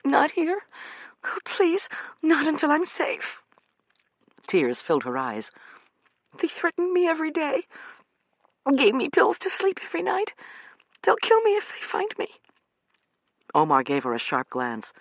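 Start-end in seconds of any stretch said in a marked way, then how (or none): 0.0-3.4 high pleading woman's voice; frightened young woman. Acted. Amanda pleading with the men, frightened and high. 6.4-12.4 high pleading woman's voice; frightened young woman. Acted. Amanda pleading with the men, frightened and high.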